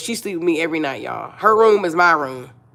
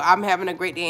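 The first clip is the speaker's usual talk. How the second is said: ordinary talk, nothing marked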